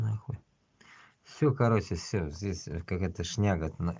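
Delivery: normal talking voice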